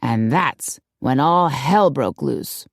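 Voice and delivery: slow, low storyteller's climax; dramatic. A narrator's reading. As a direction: draw it out for dramatic effect. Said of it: "raised her hands dramatically".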